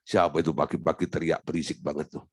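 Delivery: a hint of a low, annoyed Father in heaven; God annoyed at noisy early prayer, lightly voiced low; grumbling God. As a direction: mutter low at the noisy beggar. God peeking from heaven's window.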